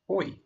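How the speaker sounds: "Oi" with the mouth moving from forward to back and wide; oi sound. Shown as a demonstration. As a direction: glide "Oi" slowly from a rounded o into i. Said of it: "It's the oi sound".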